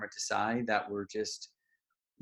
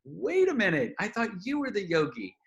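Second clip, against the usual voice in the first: high, louder double take; surprised; 9 semitones higher, 4 dB louder than usual. Retold or imitated. Realizing the woman isn't the yogi, high startled double take. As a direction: catch yourself in sudden confusion. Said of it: "And I was like".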